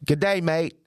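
high-pitched mock Australian accent. Shown as a demonstration. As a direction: put on a lifted Aussie greeting. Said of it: "That's just Australian".

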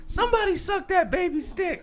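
put-on high, strained drunken holler; mock high-pitched shout; coked-up club yell. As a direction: yell it like a wasted party guy. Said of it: "he got, like, super coked up when he was drinking".

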